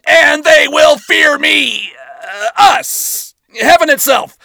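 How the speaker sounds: high, loud booming war cry; rally the troops like a commander; battlefield commander. Acted. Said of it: "yelled out in the booming voice of a battlefield commander".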